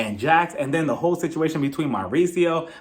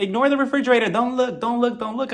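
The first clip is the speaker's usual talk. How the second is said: higher-pitched mock pleading; mock fretting; 6 semitones higher than usual. Woman telling everybody to ignore her refrigerator, mock fretting. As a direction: lightly imitate an embarrassed host waving people off. Imitates cast member fussing over her fridge.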